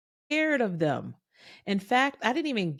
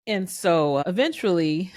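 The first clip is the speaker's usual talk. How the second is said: ordinary talk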